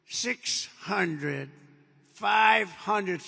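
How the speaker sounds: loud spaced altitude callout; computer voice callout. Retold or imitated. Cockpit computer counting down the altitude, loud callout. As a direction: call the numbers like the plane's computer. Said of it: "you have the computer voice".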